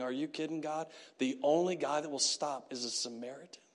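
low, quiet mock complaint to God; muted disbelief. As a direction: grumble upward in disbelief at the irony. Wounded man complains to God.

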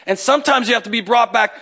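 usual speaking voice

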